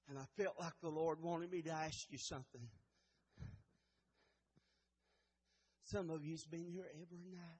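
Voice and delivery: very soft, low prayerful voice; hushed altar call. Acted. Preacher calling the church to let the Savior in, hushed. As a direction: plead gently, barely voiced, leaving long pauses.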